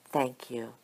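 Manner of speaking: soft, hushed thank you; softly. A narrator's reading. Micah thanking her imaginary audience, said softly. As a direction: thank them gently and quietly. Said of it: "she said softly".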